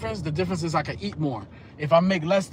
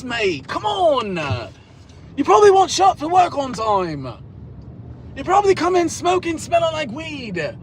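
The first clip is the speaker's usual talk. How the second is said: high, loud, sneering put-on voice of a mocking coworker; hammed-up taunt, shouted and much higher than his own voice; 11 semitones higher, 5 dB louder than usual. Coworkers mocking him as a Yankee.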